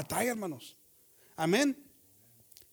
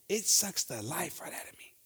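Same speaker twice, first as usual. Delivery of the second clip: unvoiced, slow whispered complaint; weary whisper about work; drained whisper; 3 semitones lower, 6 dB quieter, slower than usual. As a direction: let the life drain out of your voice. Drained worker.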